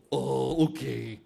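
low, soft, dopey assent; deep, drawn-out snake voice; gullible python. Acted. Python agrees to be tied, low and slow.

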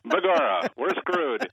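high, loud stage-Irish brogue; a hammy leprechaun Irish lilt; Irish accent. Pretend. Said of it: "you sound Irish there".

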